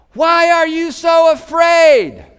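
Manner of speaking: slow, loud, high shout; yelled question. Retold or imitated. Jesus yells the question to frightened disciples. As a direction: bellow the question over the storm. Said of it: "He's having to yell".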